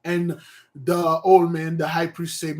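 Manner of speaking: usual speaking voice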